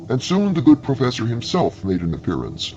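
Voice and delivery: ordinary delivery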